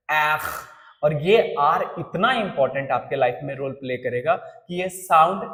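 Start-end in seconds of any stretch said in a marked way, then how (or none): 0.1-0.4 एख said as a throaty French R, breathed from the back of the throat; French R sound. Shown as a demonstration. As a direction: say एख with the R scraped in the throat. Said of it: "थोड़ा practice करना पड़ेगा".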